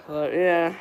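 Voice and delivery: usual speaking voice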